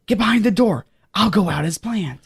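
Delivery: usual speaking voice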